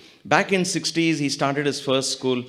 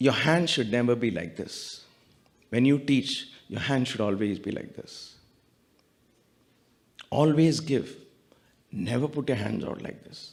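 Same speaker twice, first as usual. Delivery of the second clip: hushed, gentle teacher's counsel; tender retold blessing; 3 semitones lower, 6 dB quieter than usual. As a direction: speak softly and low, as a guru reassuring students. Guru's tender blessing to students, retold.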